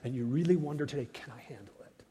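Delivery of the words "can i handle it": hushed, unvoiced self-doubt; whispered doubt. Worried listener asking himself, whispered. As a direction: whisper it like a private fear.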